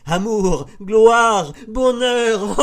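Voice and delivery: high, loud ecstatic calls from the masthead; ardent, mad cry. A narrator's reading. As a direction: cry each word out wildly, pitched very high. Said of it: "Une voix de la hune ardente et folle crie".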